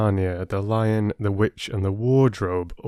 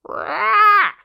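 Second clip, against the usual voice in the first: very high, loud cawing cry; crow caw; 7 dB louder than usual. Acted. Crow celebrating its drink, high loud caw. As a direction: let out a triumphant crow's caw.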